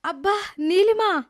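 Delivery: loud, slow cry of pain. Acted. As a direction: cry out as the bruised back is touched. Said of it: "అంటూ గట్టిగా అరుస్తోంది".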